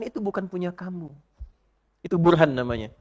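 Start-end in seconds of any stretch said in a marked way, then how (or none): 0.0-1.1 softer inner conscience, "bukan" leaned on. Acted. Heart's voice warning about the sandals, soft. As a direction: let the conscience speak quietly. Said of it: "gejolak hati mengatakan".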